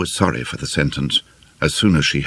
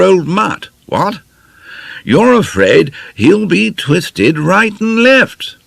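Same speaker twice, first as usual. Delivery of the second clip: slow, much higher, louder toff voice; Beaky the silly toff; 8 semitones higher, 5 dB louder, slower than usual. A narrator's reading. Beaky blustering to Lena, high slow toff voice. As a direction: ham up the dim upper-class chap.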